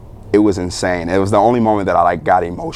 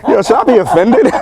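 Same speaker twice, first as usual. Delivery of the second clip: high, louder, breaking into laughter; laughing question; 5 dB louder than usual. Retold or imitated. Patient laughing at his aunt's odd remark, high and laughing. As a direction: laugh it out, mock offended.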